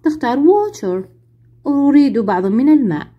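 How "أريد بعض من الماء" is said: usual speaking voice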